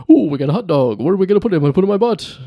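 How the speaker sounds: a lightly voiced Robin Williams riff; Robin Williams impression. Podcaster riffing like Robin Williams on a hot dog, lightly voiced. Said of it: "free associating just like Robin Williams".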